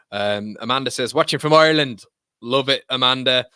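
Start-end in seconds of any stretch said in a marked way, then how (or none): 1.1-2.0 loud, higher-pitched put-on Irish accent. Streamer reads a viewer's message from Ireland, Irish accent. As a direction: belt it out in an Irish brogue. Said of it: "more Irish accent".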